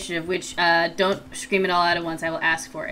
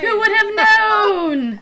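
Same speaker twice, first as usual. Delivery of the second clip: high, loud mock astonishment; exaggerated fake shock; sarcastic shout; 14 semitones higher, 5 dB louder, slower than usual.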